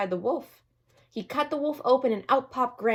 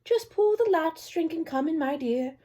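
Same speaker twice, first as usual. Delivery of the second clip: put-on high old-lady voice, softening at the end; a trusting granny welcoming her visitor; kindly grandmother; 8 semitones higher than usual. A narrator's reading. Grandmother in bed inviting the girl in, high and kind.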